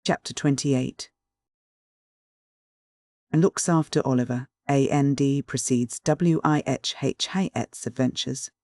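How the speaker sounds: ordinary delivery, nothing marked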